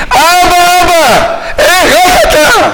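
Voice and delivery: high, drawn-out pleading shout; impassioned, slowing wail of appeal; crying out. Acted. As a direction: cry out to a heavenly father. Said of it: "עשרים ושש פעמים אנחנו זועקים".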